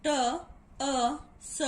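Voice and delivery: "T, A, S" as three isolated sounds with gaps; sounded out. Shown as a demonstration. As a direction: spell out the sounds of tus one by one. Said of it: "the next syllable tus".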